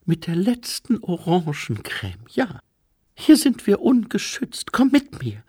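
high-pitched, urgent female figure; Lady Lejeune. Acted. Lady Lejeune urging her listeners to leave the unprotected spot, high and pressing.

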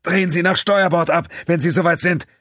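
calling over the engines. A narrator's reading. Terrell calls to the pilot over the engine noise, shouting. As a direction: shout the order above the roar. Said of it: "rief Terrell, den Maschinenlärm übertönt".

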